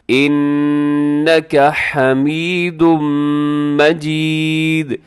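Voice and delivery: loud, very slow chant; full-voiced melodic Arabic recitation; darood recitation. Shown as a demonstration. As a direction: project long held vowels with pauses between phrases. Teacher recites second darood aloud.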